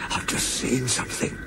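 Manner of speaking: frightened, hushed and halting; shaky voice. A narrator's reading. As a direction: tremble softly as if you saw something awful. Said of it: "said Toby in a shaky voice".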